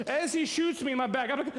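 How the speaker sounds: normal talking voice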